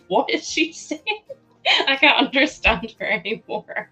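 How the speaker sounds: playful impression of bewildered audience; baffled viewers. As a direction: imitate confused listeners through giggles. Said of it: "You might all be like".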